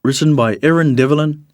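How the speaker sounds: usual speaking voice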